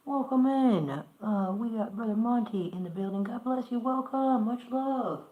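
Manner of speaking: big, loud, high welcome shout-out; loud hype welcome. Real, not acted. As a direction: hype the guest in like a host. Hyping a guest arriving.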